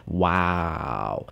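low, soft, long sliding wow; drawn-out awe. Pretend. Marvelling at a perfect grape, drawn-out awe. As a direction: let it sink slowly downward.

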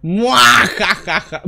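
slow evil villain laugh "muajajaja"; mustache twirling villain. Acted. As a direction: cackle like a cartoon villain.

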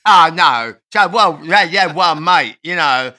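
loud, drawling rock-star impression. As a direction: ham up an ageing rocker reminiscing, slowing as you go.

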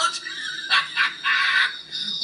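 shrieking high cackle; wild laugh. Acted. Brain laughing at Raz leaving, high manic laugh.

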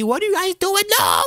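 high-pitched hammed-up panic; shrieked mock alarm; panicked scream. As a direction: cry out as if cornered. Cats corner the player in game.

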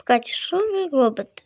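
normal talking voice

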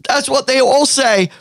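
high sneering heckle; mock the hero's excuse; high snide bystander; heckler. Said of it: "somebody says".

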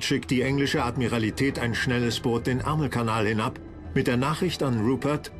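usual speaking voice

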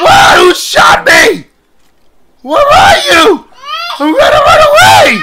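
high, loud panicked shouts; terrified screams under attack; panicked screaming. Real, not acted. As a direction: yell in terror while fleeing. Attacked in the dark.

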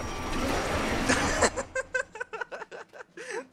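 high laugh. Real, not acted. Laughs at failed round.

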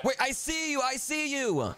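high, urgent call; excited call. Acted. Spotting lost brother, high excited call.

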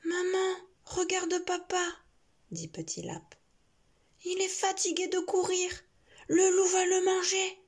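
worried little bunny; little rabbit voice. Acted.

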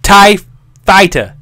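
high, shouted words with a gap between; loud mic test. Shown as a demonstration. Testing if the words shape the waveform, shouted. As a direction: shout each word separately into the mic. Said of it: "If you say tie Fighter into it".

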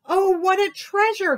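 high, loud chirpy bird voice; chirping bird. Acted. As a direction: chirp it like a thrilled little bird. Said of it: "he chirped".

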